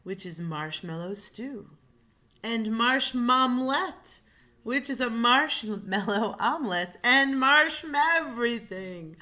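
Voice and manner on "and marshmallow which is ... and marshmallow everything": building excitement. A narrator's reading.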